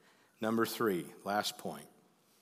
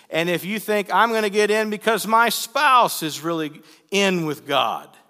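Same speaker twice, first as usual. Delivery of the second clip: high, loud, urgent preacher voice; preaching at full force; 10 semitones higher, 13 dB louder than usual. Real, not acted. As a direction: drive it home from the pulpit, loud and raised.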